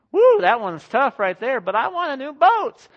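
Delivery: loud, high, playful protest; joking excitement. Real, not acted. Pastor jokes to husbands about buying a boat, loud and high.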